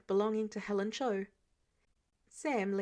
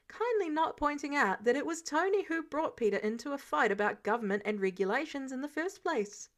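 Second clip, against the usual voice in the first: plain reading voice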